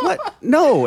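high, shocked "what, no". Retold or imitated. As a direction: squeak it out in disbelief. Retold shocked refusal.

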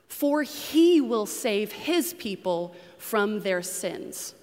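slow reading with HE punched in "for he will save"; stress on pronouns. Shown as a demonstration. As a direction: lean hard on "he" and "his", slowly. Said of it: "I've kind of bolded the pronoun".

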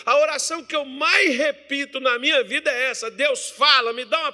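high-pitched, insistent sermon delivery; fervent preaching. Real, not acted. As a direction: preach it with urgent conviction.

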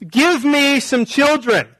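hammed-up wife demanding children; mock angry demand. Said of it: "She's mad at him".

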